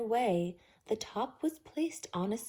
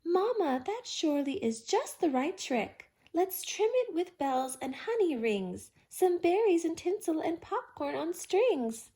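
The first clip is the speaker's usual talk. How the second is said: eager, higher bear voice; excited bear; 6 semitones higher, 3 dB louder than usual. A narrator's reading. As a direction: rattle off the ideas happily.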